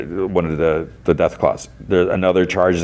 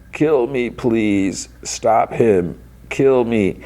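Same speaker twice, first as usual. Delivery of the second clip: drawn-out, slightly raised groaning plea; distorted voice; 5 semitones higher, much slower than usual. Retold or imitated. Severed head begs Lucy, distorted drawn-out voice. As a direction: imitate a distorted, barely living head. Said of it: "in a distorted voice".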